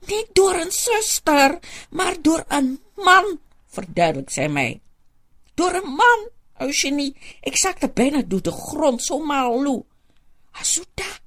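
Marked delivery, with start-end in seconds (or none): 0.1-3.4 hoog en langzaam, geschokt; verontwaardigde oude vrouw. Acted. Soetie's outraged voice. 5.5-9.8 hoog en langzaam, geschokt; verontwaardigde oude vrouw. Acted. Soetie's outraged voice. 10.5-11.2 gefluisterd. Acted. Hushed reassurance.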